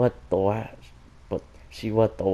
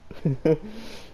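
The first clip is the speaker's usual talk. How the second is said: embarrassed giggle; 7 semitones higher, 3 dB quieter than usual. Real, not acted. Reader giggles mid-scene.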